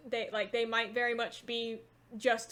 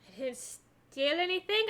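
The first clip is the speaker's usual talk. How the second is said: higher-pitched keening crone protesting; crazed old woman; 4 semitones higher than usual. Acted. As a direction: deny it as a wary old hag. Old woman denies theft in character.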